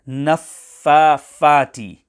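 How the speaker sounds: naffafati with an f where the th belongs; fa replacing tha. Shown as a demonstration. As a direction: say naffafati slowly, a pupil's mistake with a second "fa" for "tha". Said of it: "in place of tha".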